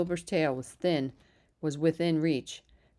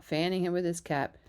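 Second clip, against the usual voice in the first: ordinary delivery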